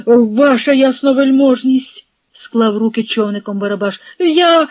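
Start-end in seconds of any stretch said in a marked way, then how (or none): 0.1-2.1 louder, higher plea to a superior; raised, imploring address; pleading appeal. A narrator's reading. Officer pleads with the hetman. 4.2-4.7 high, loud start of a plea, cut off; raised voice, interrupted; urgent interjection. A narrator's reading. Pleader starts, gets cut off.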